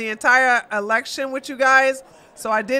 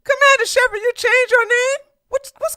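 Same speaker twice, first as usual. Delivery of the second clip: surprised; 11 semitones higher, 4 dB louder than usual. Real, not acted. Surprised at viewer's new name.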